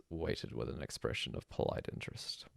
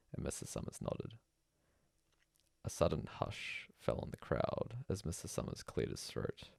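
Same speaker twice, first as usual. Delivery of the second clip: ordinary delivery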